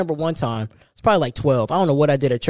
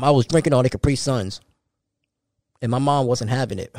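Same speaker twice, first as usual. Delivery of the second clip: ordinary talk, nothing marked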